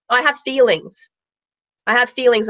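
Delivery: ordinary delivery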